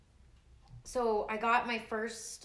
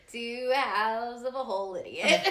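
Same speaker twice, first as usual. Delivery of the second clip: laughing; 3 semitones higher, 7 dB louder, slower than usual. Real, not acted. Joke tagline said laughing.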